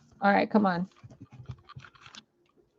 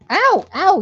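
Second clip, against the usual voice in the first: sharp, high, loud yelp of pain; real pain; 10 semitones higher, 11 dB louder than usual. Real, not acted. Owner yelping as the chihuahua's nails scratch her. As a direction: cry out at the scratch.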